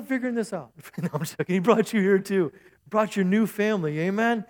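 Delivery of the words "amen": ordinary talk, nothing marked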